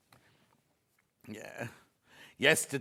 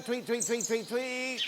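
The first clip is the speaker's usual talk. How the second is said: high-pitched little chirps; playful high birdsong imitation; bird tweeting; 7 semitones higher, slower than usual. Imitates birds while starting up.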